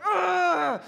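furious high yell of frustration; a loud, high shouted ugh of rage; angry shout. Retold or imitated. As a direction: let out an enraged ugh at the wreck. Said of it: "And I'm angry. I'm like".

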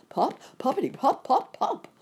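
high, punchy pops; popping noises. A narrator's reading. Narrator imitating balloons bursting under the airship, high sudden pops. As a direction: pop the words out like bursting balloons.

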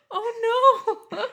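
high, gasping mock horror; gasp, then an exaggerated high cry of dismay; Groot. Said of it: "Groot does that also".

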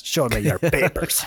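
put-on German guard voice; badly faked German accent; mock German accent. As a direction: demand papers like a hammy German officer. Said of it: "It won't let me sound German".

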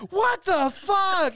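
high, slow, stunned what the fuck; disgusted teenage shock; shocked outburst. Retold or imitated. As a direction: recoil in disbelief, drawn out. Teens shocked by dick pics.

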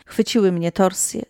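ordinary delivery, nothing marked

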